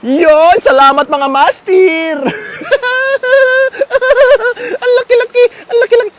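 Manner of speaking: high and loud with joy; almost crying with happiness; overjoyed, voice cracking high; excited. Real, not acted. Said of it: "medyo na-excite ako dun".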